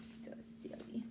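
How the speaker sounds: soft unvoiced whisper; hushed aside; whispered comment. Real, not acted. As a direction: whisper it with amusement. Teacher comment to class.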